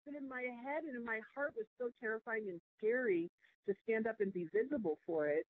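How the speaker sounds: ordinary talk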